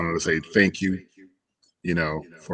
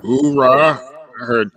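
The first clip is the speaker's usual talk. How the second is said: loud, high hoorah; Marine battle cry; 7 semitones higher, 6 dB louder, slower than usual. Real, not acted. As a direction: bark it like a Marine.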